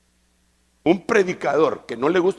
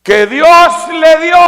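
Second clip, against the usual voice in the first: slow, emphatic pulpit reading; solemn proclamation with loud surges; declamatory scripture reading; 6 semitones higher, 10 dB louder, slower than usual. Real, not acted. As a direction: proclaim the verse, stretching the words. Reads scripture aloud to congregation.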